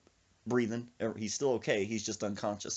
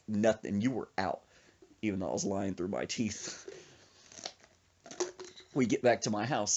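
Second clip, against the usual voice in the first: ordinary talk, nothing marked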